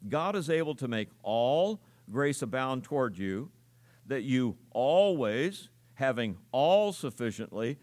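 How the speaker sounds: "all", "always" and "every" stretched out and punched; verse read with "all", "always", "every" standing out; stress on all, always, every. Shown as a demonstration. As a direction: lean hard on each "all", "always" and "every". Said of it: "I emphasize five different words".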